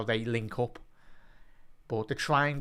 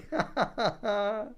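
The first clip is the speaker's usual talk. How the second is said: disbelieving laugh; 10 semitones higher than usual. Real, not acted. Laughs after missed chance.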